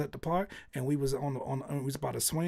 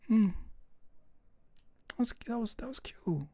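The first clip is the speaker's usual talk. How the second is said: high, soft, hesitant polite praise; lightly imitating his wife's strained compliment; 9 semitones higher, 4 dB quieter than usual. Imitating his wife being politely unimpressed by the song. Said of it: "She said".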